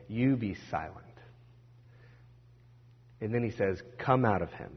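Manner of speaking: soft, low, firm order; calm, quiet authority; quiet command. Retold or imitated. Jesus quietly ordering the unclean spirit out, soft and firm.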